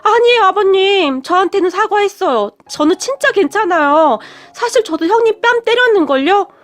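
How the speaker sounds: startled daughter-in-law hastily protests. A narrator's reading. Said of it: "화들짝 놀라며". (화들짝 놀라 말리는 며느리)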